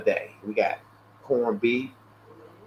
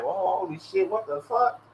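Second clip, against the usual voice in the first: mock shock; 6 semitones higher, 6 dB quieter than usual. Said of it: "It was like".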